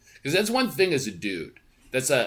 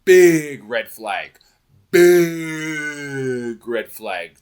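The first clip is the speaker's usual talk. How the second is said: "Big red flag" stretched very slowly and loud; slow, loud, ominous; drawn-out warning; 4 semitones higher, 10 dB louder, much slower than usual. Real, not acted. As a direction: hammer each word of the warning.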